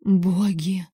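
hoarse, breathless whisper of shock; hoarse gasp. A narrator's reading. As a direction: rasp out the word in fright. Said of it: "закончив сиплым".

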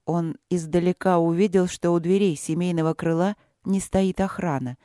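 usual speaking voice

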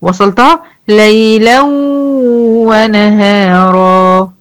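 slow recitation, "ليلا" flowing into "ونهارا"; joined ليلا ونهارا. Shown as a demonstration. المعلمة تصل ليلا بنهارا ببطء. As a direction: carry "ليلا" straight into "ونهارا" without a stop. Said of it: "خلاص مبقاش في عوض في ليلا".

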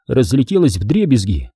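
usual speaking voice

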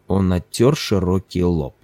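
usual speaking voice

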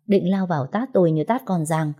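ordinary delivery, nothing marked

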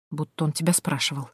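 quiet inner telling-off; self-reproach. A narrator's reading. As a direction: mildly scold yourself under the breath. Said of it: "укорила Тоня саму себя".

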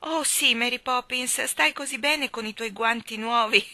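high, loud wheedling compliment; butter her up cunningly; sly flattery. A narrator's reading. Michael flattering Mary Poppins to stay, sly and loud. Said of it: "disse Michael astuto".

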